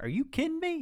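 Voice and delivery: can't believe the cop's order; pitched-way-up disbelief; retold disbelief. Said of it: "I was like".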